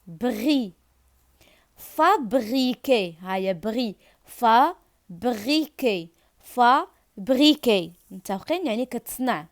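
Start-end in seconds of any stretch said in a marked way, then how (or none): 1.8-3.1 fabriquer drawn out slowly, syllable by syllable; fa-bri-quer, said slowly and clearly; slow syllable demo. Shown as a demonstration. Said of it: "teacher models a word". 4.2-7.9 fabriquer drawn out slowly, syllable by syllable; fa-bri-quer, said slowly and clearly; slow syllable demo. Shown as a demonstration. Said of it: "teacher models a word".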